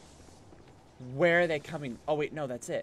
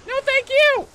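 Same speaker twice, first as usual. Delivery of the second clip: high, loud sarcastic screech; real exasperated shriek; exasperated shouting; 14 dB louder than usual. Real, not acted. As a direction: yell it in high-pitched frustration. Attacked in the game.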